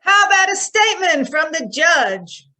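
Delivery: high, loud reporter's shout; calling out. Acted. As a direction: shout the question over a crowd. Reporter calls out to secretary.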